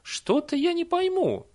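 very high, slow, drawn-out bafflement; puzzled old nanny. A narrator's reading. Nanny cannot follow the villager's tale, puzzled. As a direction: stretch it out in puzzlement.

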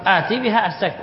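normal talking voice

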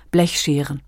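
usual speaking voice

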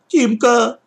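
put-on high whiny child calling out; plaintive call. A narrator's reading. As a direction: call his brother's name plaintively. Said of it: "жалобно мне крикнул". (жалобный зов)

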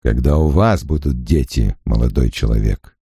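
low, soft and gentle; unusually soft. A narrator's reading. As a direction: soften your voice, dangerously calm. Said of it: "необычно мягким голосом".

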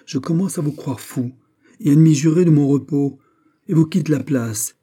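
high, indignant outburst; angry indignation. A narrator's reading. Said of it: "en jouant l'indignation à la colère".

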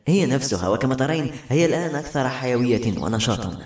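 ordinary delivery

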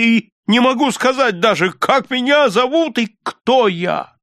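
high-pitched, mounting bewilderment; losing his mind. A narrator's reading. Rip no longer knows who he is, distraught. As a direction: plead in high, distraught confusion. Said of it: "теряя разум".

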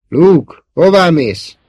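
high, loud anxious call; worried call. A narrator's reading. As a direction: call after someone leaving, worried. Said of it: "kiáltott utána aggódva".

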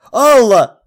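urgent shout into the phone. A narrator's reading. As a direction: call out to her urgently. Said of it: "крикнул я".

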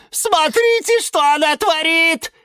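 high-pitched cry to the whole house; alarmed shout. Acted. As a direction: raise the alarm at the top of a squeaky voice. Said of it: "крикнул Лимон Филимон".

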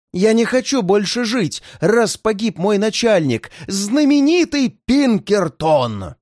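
high, slow despairing cry; a hopeless prisoner begging for death; desperate. Acted. Bob in despair, boss dead. (отчаянный крик)

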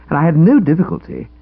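plain reading voice

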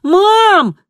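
long, high, desperate yell; shouting. A narrator's reading. Kristina calling again to her mother, loud shout. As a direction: yell for Mom with full force. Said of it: "и снова выкрикнула".

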